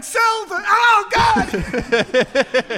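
high, loud frantic yelling; panicked shouting. Pretend. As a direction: yell in a total panic on the trading floor. Panicking trader on the stock floor.

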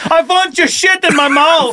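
high, slow, heavy mock German accent; hammed-up German soldier, drawing out each word. Talking toilet making demands of its user, mock German soldier. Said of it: "It's the voice of a German sh-, soldier".

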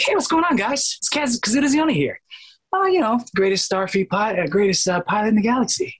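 high-pitched cartoon pilot voice; high animated-character demo; Kaz. Shown as a demonstration. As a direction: raise the voice way up, cheerful and boastful. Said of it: "And I raised my voice".

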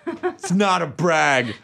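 Southern accent. Shown as a demonstration. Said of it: "Yours is good".

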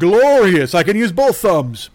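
loud, grandiose mock delight; hammed-up triumphant declaration; mock gloating. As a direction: exult theatrically over a tiny freedom. Repeats mock gloating over interruption.

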